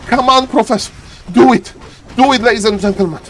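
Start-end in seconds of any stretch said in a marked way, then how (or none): none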